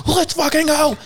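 high-pitched mock rallying cry; imitating excited fans. Old fans rushing in as the band starts, imitated excitement. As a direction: squeal it like a fan charging in. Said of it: "all the O G fans were like".

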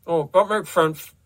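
muffled, loud mouth-full mumble; mumbling, mouth full. A narrator's reading. Desmond answering Carmina with his mouth full, mumbling. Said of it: "he mumbled with a tit in his mouth".

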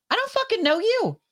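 very high, heated tell-off; furious very high-pitched dismissal; angry rant. Real, not acted. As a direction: spit it at a stranger who won't quit. Ranting about callers.

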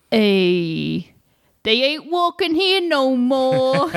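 put-on slow, mournful New York accent; a grieving New Yorker, drawn out; New Yorker at a funeral. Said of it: "this is an impression of a, uh, New Yorker at a funeral".

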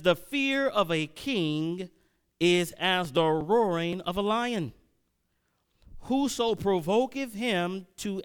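slow, emphatic Bible reading; scripture reading. Acted. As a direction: read the verse out with weight, drawing out the key words. Reads scripture aloud to congregation.

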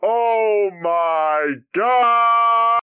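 loud, drawn-out shocked cry; shocked outcry. Real, not acted. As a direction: wail at the bad roll. Rolled a natural one.

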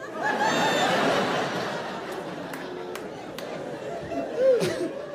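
long airy laughter; laughter rolling on. Real, not acted. Audience laughter at joke.

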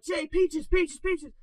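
high-pitched urgent pleading; urgent calling. Acted. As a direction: call the codeword in mock alarm. Acting out waking friend from night terror.

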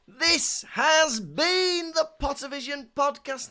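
high, slow announcer voice. Pretend. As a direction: sign off like a cheesy host.

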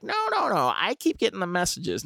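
put-on high old lady insisting; insistent old neighbor. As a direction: pitch up on the no's. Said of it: "she's like".

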